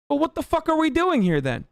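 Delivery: high-pitched exasperated rant line; high, incredulous wail of a question; incredulous outburst. Acted. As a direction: hold it high in disbelief, then drop at the end. Mock-incredulous outburst at the country.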